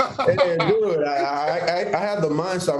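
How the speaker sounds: high, laughing admission; sheepish laughing reply to the host. Real, not acted. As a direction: laugh it off while answering. Laughing after being teased.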